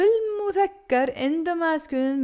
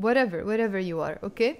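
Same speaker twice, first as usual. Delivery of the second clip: ordinary talk, nothing marked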